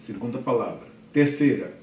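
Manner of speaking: ordinary delivery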